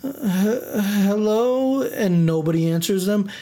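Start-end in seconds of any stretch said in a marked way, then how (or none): 0.0-1.8 timid scary-movie hello. Retold or imitated. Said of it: "that scene in a scary movie".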